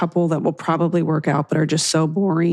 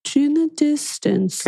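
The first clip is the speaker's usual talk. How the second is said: mock high voice; 9 semitones higher, slower than usual. Echoing partner in a mock voice.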